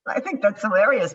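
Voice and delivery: ordinary talk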